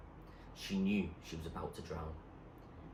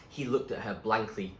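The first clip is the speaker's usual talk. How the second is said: usual speaking voice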